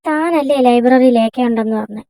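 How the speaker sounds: ordinary talk, nothing marked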